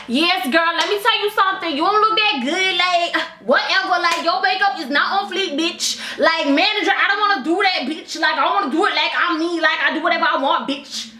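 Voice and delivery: high, brash sales-floor attitude; mock sassy employee. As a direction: ham up a rude, defiant employee, pitched high. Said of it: "talking like".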